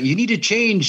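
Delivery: a hint of a scolding father; mock father lecturing. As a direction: play the stern dad, lightly. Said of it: "he's like".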